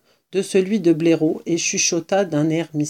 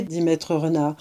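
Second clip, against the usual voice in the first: plain reading voice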